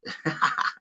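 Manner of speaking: soft, voiceless laugh; breathy chuckle. Real, not acted. Streamer joking about payday running out, soft breathy laugh. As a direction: chuckle breathily at your own joke. Laughing at own stale bread joke.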